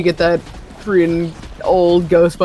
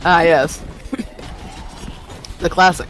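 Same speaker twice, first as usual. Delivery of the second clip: dry, slightly lower-pitched sarcasm; sarcastic; 4 semitones lower, 4 dB quieter than usual. Real, not acted. Gamer answering a friend's film quote, sarcastic. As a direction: say it with understated mock appreciation. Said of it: "like, sarcastic".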